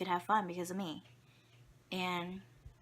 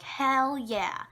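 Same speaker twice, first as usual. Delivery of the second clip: louder and higher victory shout; triumphant cheer; 6 semitones higher, 6 dB louder than usual. Retold or imitated. Student solving a math problem cheers, louder and higher. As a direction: celebrate getting the math problem. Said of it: "I'm like".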